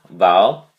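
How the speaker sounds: val said loud and punched; stress on val. Shown as a demonstration. Teacher drilling the first syllable, loud val. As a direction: hit val hard. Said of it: "the emphasis on the first syllable".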